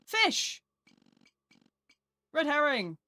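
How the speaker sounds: gleeful cry of discovery; excited shout. Real, not acted. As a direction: yelp it out as you spot it. Sudden find in game.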